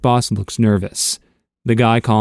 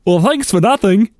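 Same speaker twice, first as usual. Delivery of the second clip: put-on high, loud Russian accent; a parting jab in a heavy Russian accent; 10 semitones higher, 4 dB louder than usual. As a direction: toss it off in a thick Russian accent. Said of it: "said in his Russian accent".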